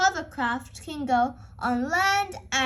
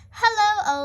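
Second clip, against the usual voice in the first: Mister Stallion greeting; 6 semitones higher, 5 dB louder than usual. A narrator's reading. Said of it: "says Mister Stallion".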